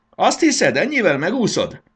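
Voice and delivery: very high, loud war cry; battle cry. A narrator's reading. Fairy challenges the caterpillar threatening Marci, a battle cry. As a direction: shout defiantly into battle. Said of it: "hangzott fel Fanny csata kiáltása".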